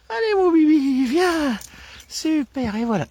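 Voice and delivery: excited high-pitched praise for the puppy; high happy recall voice. Shown as a demonstration. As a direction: lure the puppy back with a high cheerful voice. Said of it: "l'intonation de ma voix, donc une voix aiguë".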